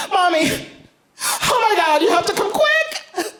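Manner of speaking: put-on high, urgent child's voice; high little-girl panic; 1 panicked little girl. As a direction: squeal it like a scared four-year-old.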